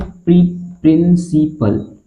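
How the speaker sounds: प्रिंसिपल drawn out slowly after a clipped प्री; teacher sounding out a word; syllable by syllable. Shown as a demonstration. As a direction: break प्रिंसिपल into its parts, slowly. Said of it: "इसको क्या पढ़ते हैं".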